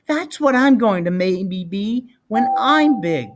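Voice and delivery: put-on proud little boy; child's voice. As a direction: kid boasting about growing up. Child voice in read story.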